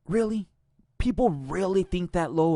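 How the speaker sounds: high, scoffing "Really?"; can't believe what he's hearing; sharp disbelieving question; incredulous. Real, not acted. Disbelief at dive claims.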